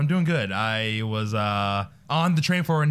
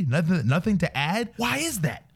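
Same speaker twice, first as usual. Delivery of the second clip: normal talking voice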